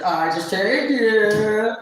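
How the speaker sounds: mocking Adrian. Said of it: "And he's like".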